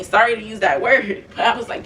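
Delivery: ordinary delivery, nothing marked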